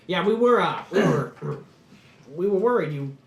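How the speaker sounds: high, loud, hesitant stammer; lightly acted flustered companion. GM voices the dwarf, hesitating.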